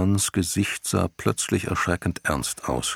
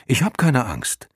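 put-on defiant boy voice; 6 semitones higher than usual. Bastian boasts.

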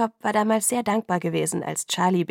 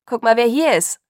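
loud, higher, excited announcement; calling out; 4 semitones higher, 8 dB louder than usual. A narrator's reading. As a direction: call to your father that a visitor has come. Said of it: "rief er". (laut und freudig ins Haus rufen)

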